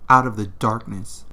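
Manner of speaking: plain reading voice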